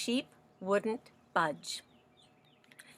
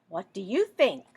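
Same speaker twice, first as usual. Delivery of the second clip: usual speaking voice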